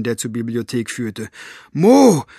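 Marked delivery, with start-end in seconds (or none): 1.7-2.3 high, loud cry of the name; called out. A narrator's reading. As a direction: call out for Mo, raised sharply in pitch. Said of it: "rief sie". (laut und hoch gerufen)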